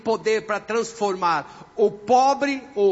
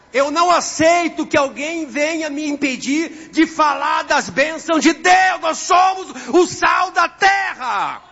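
loud, high, drawn-out proclamation; fervent raised pulpit voice; full-force preaching; 7 semitones higher, 6 dB louder, slower than usual. Real, not acted. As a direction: preach it out to the whole congregation, stretching key words.

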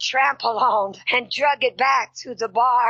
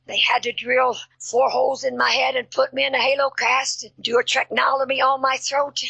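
ordinary talk

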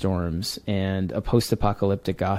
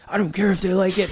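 defiant partner; 11 semitones higher, faster than usual. Retold or imitated. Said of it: "And he'd be like".

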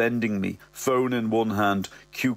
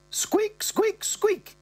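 high squeaky imitation of bed springs; mock squeak; much slower than usual. Mother imitating bed springs, high squeaks. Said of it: "she goes".

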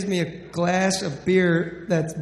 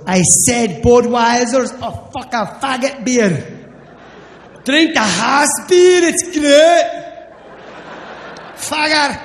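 loud, higher Scottish slur, slow on the beer; drunk Scottish bartender; 5 semitones higher, 5 dB louder than usual. Retold or imitated. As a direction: bark like a drunk Scottish barman. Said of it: "The guy goes".

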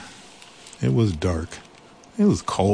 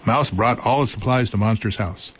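ordinary delivery, nothing marked

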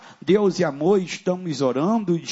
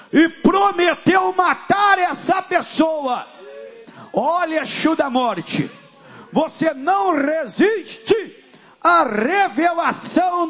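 exorcism preaching; 9 semitones higher than usual. Real, not acted. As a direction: command the demon out, driving the crowd's chant. Preacher leads a shouted exorcism. (pregação de libertação aos gritos)

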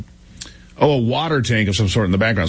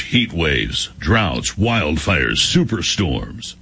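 low, dramatic newsreader voice; announcer voice; 6 semitones lower, much slower than usual. Acted. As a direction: read it like a deep-voiced radio announcer. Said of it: "That's pretty good announcer voice".

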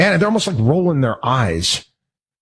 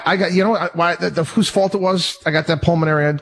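normal talking voice

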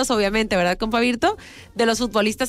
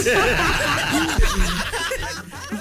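high burst of laughter; 8 semitones higher than usual. Real, not acted. Host laughing at a listener's tall tale, high laugh. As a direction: let the laugh rise high and fall.

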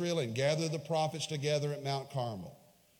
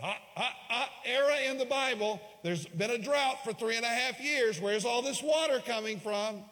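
high, louder mock scoffer; scoffing skeptic; 9 semitones higher, 5 dB louder than usual. Preacher mimicking Bible critics, high smug mock scoff. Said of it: "people who question the Bible said".

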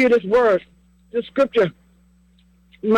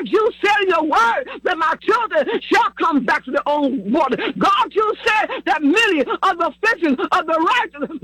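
driving, chanted sermon cadence; fervent preaching; 5 semitones higher than usual. Real, not acted. Preacher calling on God to keep his word, fervent preaching prayer. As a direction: press each phrase home with insistence. Preaches and prays at full force.

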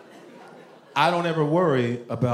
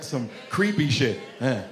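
usual speaking voice

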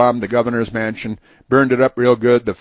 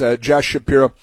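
ordinary talk

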